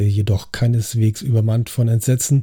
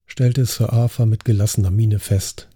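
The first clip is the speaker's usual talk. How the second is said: ordinary delivery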